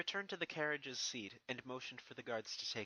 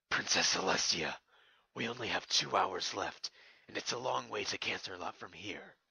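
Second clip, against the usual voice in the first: lower, louder guard voice reporting in a hurry; panicked guards; 4 semitones lower, 7 dB louder than usual. Acted. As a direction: blurt out the urgent news to the princess. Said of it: "panicked and worried".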